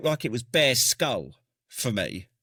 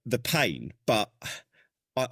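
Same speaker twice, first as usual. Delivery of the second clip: ordinary talk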